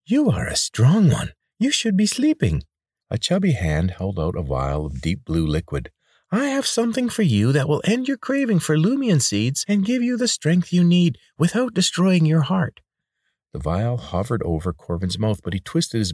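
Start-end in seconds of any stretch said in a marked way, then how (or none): 0.0-2.6 high, oily voice of a chubby healer; pudgy man. A narrator's reading. Chubby healer coaxing the injured boy, high and oily. 6.3-12.8 high, oily voice of a chubby healer; pudgy man. A narrator's reading. Chubby healer coaxing the injured boy, high and oily.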